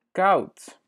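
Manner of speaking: koud said high, "kout" with a falling tone; teacher models koud for the learner; Dutch word koud. Shown as a demonstration. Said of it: "teacher models Dutch word".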